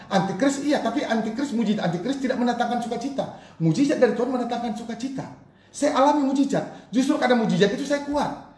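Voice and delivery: high, loud, full-force preacher's delivery; impassioned preaching. Real, not acted. Preacher testifying to the congregation about miracles, impassioned and loud. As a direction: proclaim it fervently at a high, sustained pitch.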